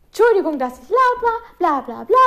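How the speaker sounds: high singsong imitation of a cheery teacher; mock-cheerful apology. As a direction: toss off the apology in a falsely chirpy voice. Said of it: "die sagt das immer so fröhlich".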